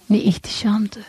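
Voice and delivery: breath-only, hushed wonder; hushed reminiscence; awed whisper. A narrator's reading. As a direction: whisper it in awe. Hushed awe at remembered stars.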